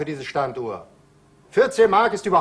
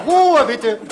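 loud, laughing call for silence; 5 semitones higher, 6 dB louder than usual. Acted. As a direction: call the room to order. Calls laughing room to order.

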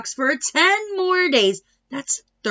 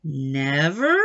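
doubtful question; 9 semitones lower than usual. A narrator's reading. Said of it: "said Tina's mother".

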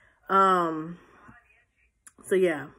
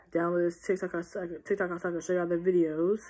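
normal talking voice

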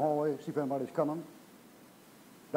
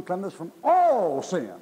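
"all" stretched long, high and loud; preacher belting out "all"; stress on "all"; 3 semitones higher, 5 dB louder, slower than usual. Retold or imitated. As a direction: hit "all" hard and hold it. Insists that all means all.

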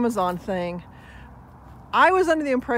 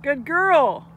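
louder, higher praise for the dog; 5 semitones higher, 6 dB louder, much slower than usual. Real, not acted. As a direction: call out praise across the park.

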